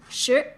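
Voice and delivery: "Sure" gliding upward like a question; second tone. Shown as a demonstration. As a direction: model "Sure" with a rising tone, R barely reached. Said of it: "with the second tone".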